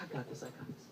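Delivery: whispered, calm; hushed reassurance. Retold or imitated. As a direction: quietly take control. Friend stepping in calmly.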